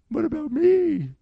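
high put-on voice of a hurt new wife; imitating his wife. New wife left behind mid-coffee, high mock hurt voice. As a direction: squeak it out like she's been abandoned. Wife's imagined hurt thought.

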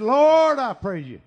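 booming preacher prayer, shouted at the start; shouting Lord in prayer; loud prayer. Retold or imitated. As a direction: call out to the Lord at full voice. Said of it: "I prayed loud back then".

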